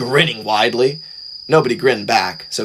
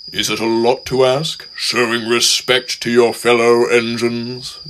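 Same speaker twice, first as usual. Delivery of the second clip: grave. A narrator's reading. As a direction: ask it gravely, slowing down. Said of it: "asked Gordon gravely".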